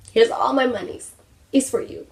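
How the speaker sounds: high, silly put-on voice; mock surrender. Overwhelmed shopper giving the store their money, high and silly. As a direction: hand it over in a high little voice. Acts out handing over money.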